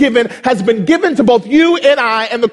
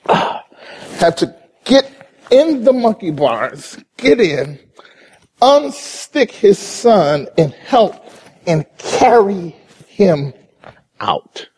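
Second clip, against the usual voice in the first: slow, drawn-out climax; 4 semitones lower, slower than usual. Acted. Slow emphatic climax of story.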